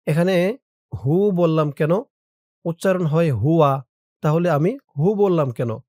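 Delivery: ordinary talk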